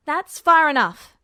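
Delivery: loud, firm stop-there command; a loud, commanding warning; sharp warning. A narrator's reading. Edward warning approaching men.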